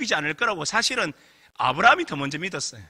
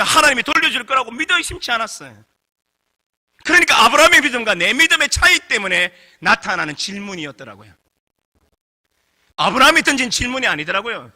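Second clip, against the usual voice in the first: ordinary talk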